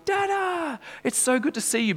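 put-on high, bright child voice calling Dada; little daughter's greeting. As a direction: squeal it like an excited little girl. Preacher voices daughter greeting him.

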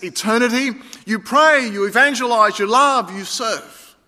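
rousing sermon climax; fervent preaching. Acted. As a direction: drive the call to action home. Preaching builds to a peak.

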